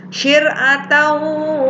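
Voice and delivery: "Syir'atawa" with the n merged into a long nasal w; merged ghunnah. Shown as a demonstration. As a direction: chant "Syir'atawa" high and slow. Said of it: "dimasukkan dan disertai gunnah".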